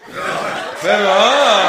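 slow, drawn-out laughing cheer; amused praise. Real, not acted. Comedian praising audience member's tiger roar, laughing. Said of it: "איך נמר עושה?".

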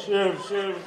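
low, muted, lifeless dentist; charisma-free dentist. Acted. Bored dentist seating a patient, low and charisma-free. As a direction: murmur it softly with zero charisma. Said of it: "זה רופא שיניים בלי כריזמה".

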